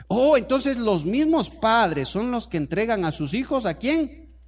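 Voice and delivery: high, loud rhetorical challenge; forceful preaching. Real, not acted. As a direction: preach it out, pressing the congregation for the answer. Preacher drives the point home. (prédica enérgica, aguda y fuerte)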